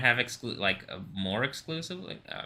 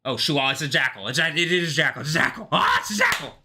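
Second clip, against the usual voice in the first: excited outburst, higher and louder, building; excited cries; 5 semitones higher, 5 dB louder, faster than usual. Real, not acted. Speaker spotting that it's a jackal, excited. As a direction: cry out, climbing higher on each repeat.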